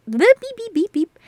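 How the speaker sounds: high bleeping sounds to cover a stumble; mock censor beep. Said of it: "Me when I can fucking talk".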